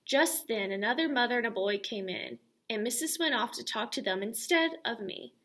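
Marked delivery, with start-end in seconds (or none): none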